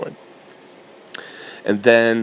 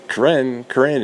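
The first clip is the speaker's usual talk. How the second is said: raised, higher call of a name; 5 semitones higher than usual. Retold or imitated. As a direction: call across the room for her. Calling out for a coworker.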